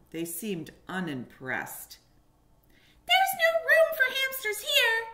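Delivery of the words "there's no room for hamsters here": tiny squeaky high mouse voice; little mouse. A narrator's reading. Mouse guarding its home from a stranger, high squeak. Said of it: "they said".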